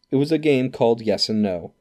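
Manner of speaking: usual speaking voice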